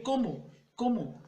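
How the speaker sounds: "Cómo" high and falling, said as a separate word; emphatic repetition. Shown as a demonstration. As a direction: drive home "Cómo" twice with a pause between. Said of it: "Dice, Como ladrón".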